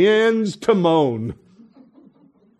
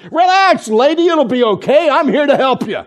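high, louder kid playing calm doctor; steady reassurance; 8 semitones higher, 4 dB louder than usual. Little doctor calming Mary behind the hay, mock steady voice. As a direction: soothe her like a tiny confident doctor. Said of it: "the words are steady as he says".

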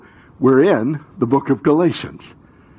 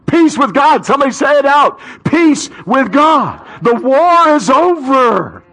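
fervent sermon climax; preaching at full force; 11 semitones higher, 5 dB louder than usual. Real, not acted. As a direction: proclaim it to the whole church.

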